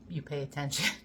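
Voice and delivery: ordinary talk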